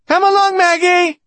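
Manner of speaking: put-on very high, loud child's call; little girl calling out. A narrator's reading. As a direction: call Maggie along as a small girl. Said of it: "Despina pronounced her name as if".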